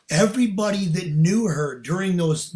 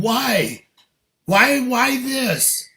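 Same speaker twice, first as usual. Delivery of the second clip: raised, drawn-out pleading why; anguished protest; 7 semitones higher, 4 dB louder, much slower than usual. Retold or imitated. Man protests to God over his dead bonsai, anguished. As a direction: cry out in exasperation, higher and louder.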